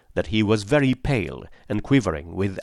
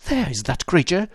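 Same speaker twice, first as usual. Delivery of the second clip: higher alarmed cry at what he sees; 7 semitones higher than usual. A narrator's reading. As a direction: call out sharply, startled. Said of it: "cried Grant Munro".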